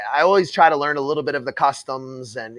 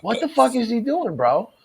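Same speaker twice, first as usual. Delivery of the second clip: laughing in disbelief; what? through a laugh. Real, not acted. Laughing at clip.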